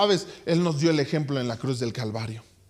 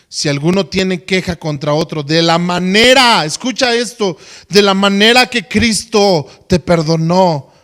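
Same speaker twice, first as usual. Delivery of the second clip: loud, declaiming scripture reading; scripture read with force; 9 dB louder than usual. Acted. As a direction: proclaim the verse to the church.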